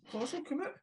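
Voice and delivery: squeaky put-on voice, well above his own; mock high voice. Teasing co-host about a friend, in a squeaky mock voice. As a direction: tease in a silly high voice.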